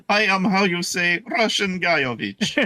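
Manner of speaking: hammy fake Russian accent. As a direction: put on a bad Russian accent. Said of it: "Makes my Russian accent sound".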